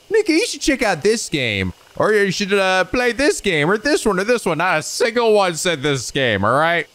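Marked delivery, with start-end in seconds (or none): 0.1-1.7 high-pitched imitated viewer suggestion; imitating an eager fan; mock commenter. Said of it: "was like". 2.1-3.7 high imitated viewer voice; high mock recommendation; mock commenter. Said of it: "was like". 3.8-4.2 high-pitched imitated viewer; high mock suggestion; mock commenter. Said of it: "was like". 4.2-4.6 high imitated viewer; high mock suggestion; mock commenter. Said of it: "was like".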